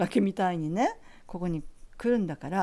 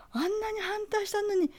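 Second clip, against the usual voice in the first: high whispered reproach; teacher's voice; 13 semitones higher than usual. Retold or imitated. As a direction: whisper it, half scolding.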